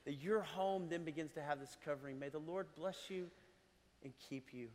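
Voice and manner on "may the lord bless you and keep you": blessing recited. Acted.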